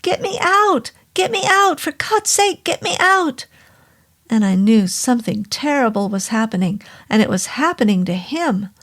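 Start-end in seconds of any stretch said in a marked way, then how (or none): 0.0-3.4 high, desperate shouting; panicked shout. Acted. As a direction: yell for help in panic. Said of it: "then he shouted".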